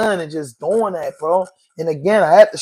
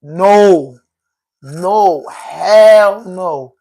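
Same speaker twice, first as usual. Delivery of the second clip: louder, insistent no's; emphatic refusal; 6 dB louder, much slower than usual. Real, not acted. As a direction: shut it down hard, each no heavier. Rejecting the idea, emphatic.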